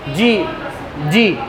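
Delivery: usual speaking voice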